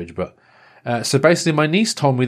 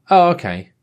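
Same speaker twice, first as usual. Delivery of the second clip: deflated surprise; 7 semitones higher, 5 dB louder than usual. Retold or imitated. Deflated reply to colleague.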